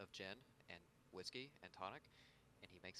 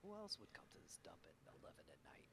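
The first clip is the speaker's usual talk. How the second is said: whispering; 6 semitones lower, 8 dB quieter than usual. Acted. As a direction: keep it low and secret. Detective whispering back.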